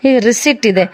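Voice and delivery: ordinary talk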